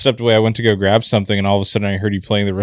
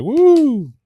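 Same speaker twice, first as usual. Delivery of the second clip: a single high, loud whoop, then gone; short imitated wedding-crowd woo; brief mock cheer; 6 dB louder than usual. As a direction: throw out one quick crowd cheer.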